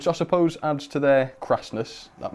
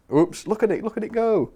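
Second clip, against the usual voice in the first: higher, excited burst of delight; excited exclamation; 10 semitones higher, 4 dB louder than usual. Real, not acted. Streamer watching his train set off, excited exclamation. Reacting to train running away.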